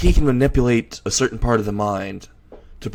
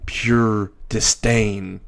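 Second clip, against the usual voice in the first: plain reading voice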